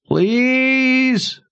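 thin, high, desperate plea; high pleading call. A narrator's reading. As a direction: call out begging. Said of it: "Oba called".

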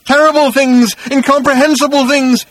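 very high, loud raving shout. A narrator's reading. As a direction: cry out in horror. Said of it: "he shouted".

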